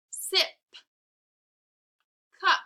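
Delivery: "Sip" and "Cup" each alone, final "p" popped; final sound stressed. Shown as a demonstration. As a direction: puff the "p" ending "Sip" and "Cup". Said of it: "really listen to my words".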